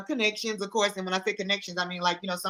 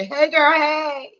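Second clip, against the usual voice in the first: excited greeting; 6 semitones higher, 6 dB louder, much slower than usual. Acted. Greeting [name] playfully.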